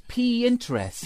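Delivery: "P interest" slowly split as pee, interest; "P interest" as two separate words, pee IN-ter-est; quoted mispronunciation. Retold or imitated. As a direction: say "P interest" as the letter P plus interest. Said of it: "how did she say it".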